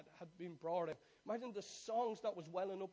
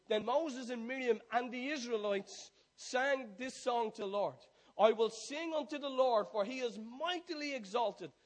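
loud, rising, jubilant sermon voice; fiery revival preaching; preaching at full force; 6 semitones higher, 13 dB louder than usual. Acted. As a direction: build the praise to a climax. Preaching climax, song and praise.

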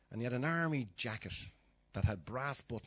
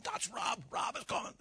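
mock shout; 11 semitones higher than usual. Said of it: "imagine him shouting to them".

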